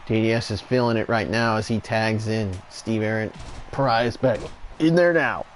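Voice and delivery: steady wrestling commentator call; live play-by-play. Acted. Commentator calling the tag match for viewers, play-by-play. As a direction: call each move as it lands.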